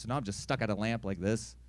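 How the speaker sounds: ordinary talk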